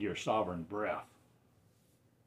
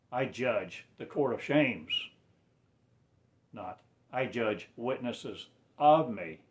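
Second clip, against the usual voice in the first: ordinary talk, nothing marked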